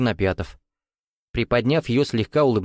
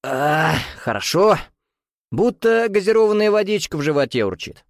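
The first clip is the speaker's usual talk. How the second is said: satisfied grunt of pleasure; 8 semitones higher than usual. A narrator's reading. Millionaire grunts after downing his champagne, with relish. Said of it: "он громко крякнул".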